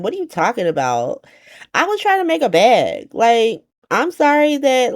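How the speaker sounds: high, exasperated mock voice; lightly voiced high-pitched diva dismissal; sassy Ava rant. Ava brushes off the DA.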